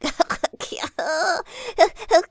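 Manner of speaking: put-on loud jolly old laugh; hearty laugh. Grandfather laughing heartily at the question. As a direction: laugh until the ears wobble. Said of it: "Der Großvater lachte".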